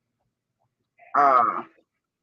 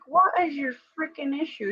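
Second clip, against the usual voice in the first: mock exasperation; 10 semitones higher, 5 dB quieter than usual. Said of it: "I'm like".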